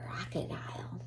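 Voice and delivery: very soft suspenseful whisper. Acted. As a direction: whisper the name to build suspense. Announcing next animal in book.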